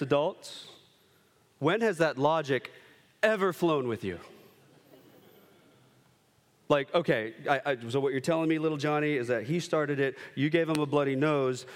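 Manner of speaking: usual speaking voice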